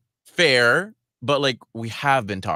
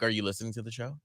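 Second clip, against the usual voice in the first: softly spoken, muted question; understated aside; 10 dB quieter than usual. Retold or imitated. Producer sharing what she thinks at the comment, quiet aside. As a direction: voice a private thought, well under normal volume. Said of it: "In my mind, it's just like".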